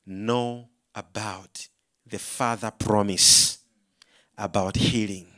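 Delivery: very low, slow, measured voice; retold Spirit's whisper. Holy Spirit answering preacher's prayer, retold whisper. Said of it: "I heard a whisper of the Holy Spirit".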